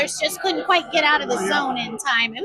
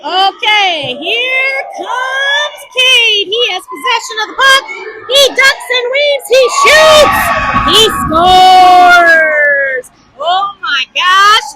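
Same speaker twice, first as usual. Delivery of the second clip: ramp up till he scores; play-by-play rising to a loud goal yell; building goal call; 2 semitones higher, 7 dB louder, much slower than usual. Real, not acted.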